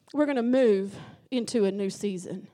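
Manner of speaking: loud, drawn-out exhortation building to a shout; rousing preaching. Real, not acted. Preacher urging the congregation to rejoice, loud rousing call.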